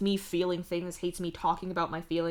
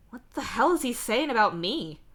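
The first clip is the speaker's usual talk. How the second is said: sudden worried outburst, higher and louder; alarmed; 6 semitones higher, 5 dB louder than usual. A narrator's reading. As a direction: ask it in alarm. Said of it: "you ask in alarm".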